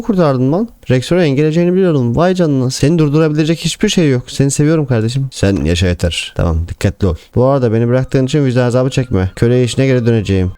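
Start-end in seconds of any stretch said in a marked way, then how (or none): none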